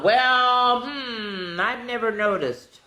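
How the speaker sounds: lightly voiced musing; puzzled donkey. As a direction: pretend to think hard. Wonky answers with puzzled hesitation.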